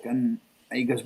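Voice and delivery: plain reading voice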